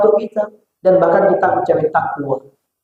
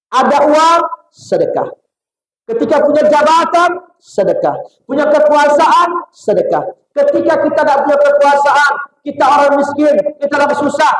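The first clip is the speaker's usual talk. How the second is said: impassioned preaching; 9 semitones higher than usual. Acted. Rhetorical list, peak intensity. (seruan khutbah yang lantang)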